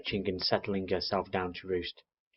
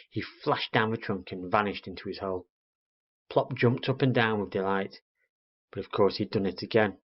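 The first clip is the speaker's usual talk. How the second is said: ordinary delivery, nothing marked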